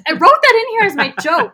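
loud, high-pitched whine of protest; mock indignant protest. Co-host imitating him complaining about a stolen joke, mock protest. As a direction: cry foul, half laughing.

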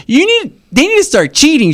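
a hint of a little girl advising dad; young daughter. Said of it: "She's like".

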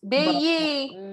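playful Baltimore imitation; imitating Baltimore accent. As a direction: try on the Baltimore sound. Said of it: "someone from Baltimore".

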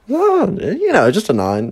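high, slow, mock-casual drawl; sarcastic nonchalance. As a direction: mimic her breezy tone with bite. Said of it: "sarcastically imitate her nonchalance".

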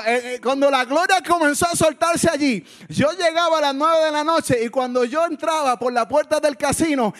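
high-pitched, impassioned preacher; preaching at full force. Real, not acted. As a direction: build the testimony with rising fervour. Preaches, building.

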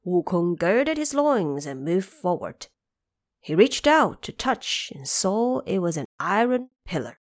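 ordinary delivery

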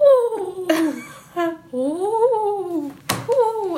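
high, loud moaning oohs; ghostly moans. Retold or imitated. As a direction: moan like a ghost. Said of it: "sounded like ghosts were having sex".

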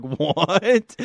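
retold astonishment with laughter behind it; incredulous reaction. Retelling own reaction to mugshot.

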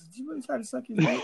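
high, sing-song parental questioning; gently probing child-directed voice; talking to a kid. Retold or imitated. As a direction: ask it like a parent to a guilty child. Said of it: "it's like talking to a kid".

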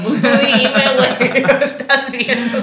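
high, loud, laughing retold protest; laughing exasperation. To the taco vendor who asks what she had, laughing and exasperated. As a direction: laugh through the complaint at the vendor.

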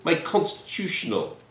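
put-on a fussy young man woken from his nap; irritated young Brit. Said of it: "And he says".

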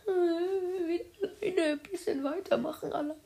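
mock whine. Reader left alone to carry on, mock whining. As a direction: whimper theatrically about being left alone.

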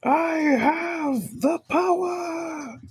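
high, slow, triumphant cry; triumphant shout. Pretend. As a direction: bellow it like a hero. Player celebrating regained health.

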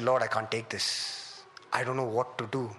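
low, subdued plea to God; retold tearful despair. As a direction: recount a helpless prayer, quiet and drained. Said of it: "And I burst out crying".

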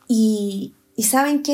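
ordinary talk, nothing marked

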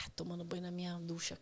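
near-whispered grumble to oneself; muttered inner thought. Retold or imitated. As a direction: mutter it under your breath, almost voiceless. Muttered thought to herself in story.

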